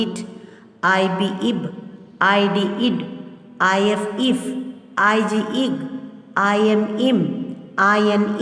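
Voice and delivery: each letter named, then the short i word, as in I N, in; short clear i in each word, as in pin; short i drill. Shown as a demonstration. Teacher drilling children: letter pairs, then short i words. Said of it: "Now pronounce I as in pin".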